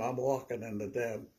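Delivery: ordinary talk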